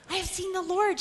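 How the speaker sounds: high, bright exclamation of the news; Mary telling the disciples, joyful; joyful announcement. Retold or imitated. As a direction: burst out with the good news. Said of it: "she said".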